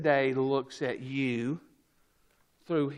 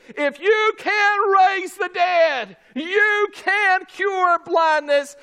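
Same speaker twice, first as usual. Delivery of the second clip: high, slow, full-voiced shout; preacher's emphatic shout, every word drawn out; emphatic declaration; 10 dB louder, slower than usual. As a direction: declare it with total conviction. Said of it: "They said unto him emphatically".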